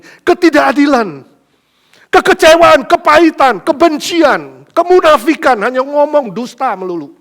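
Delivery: loud, high, building list; fervent preaching. Acted. Preacher listing the world's evils, loud and high.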